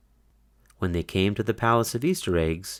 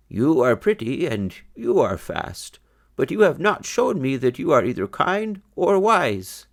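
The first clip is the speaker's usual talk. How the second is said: put-on raised, kindly old rabbit voice; old grandfather bunny; 6 semitones higher, 3 dB louder than usual. A narrator's reading. Grandfather bunny judging the fast rabbits, higher voice. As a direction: lift the voice for the wise old grandfather.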